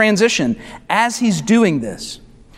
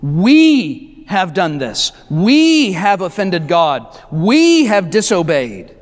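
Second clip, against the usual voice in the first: slow, lower phrases, each We stretched out and rising before a fall; stress on We; 4 semitones lower, slower than usual. Retold or imitated. Preacher voicing Ezra owning the nation's sin, stress on We. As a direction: lean long and hard on every "We", then let the rest drop. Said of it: "He uses language like "we".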